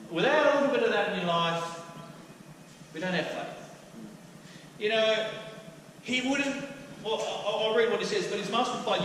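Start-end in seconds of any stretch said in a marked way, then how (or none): none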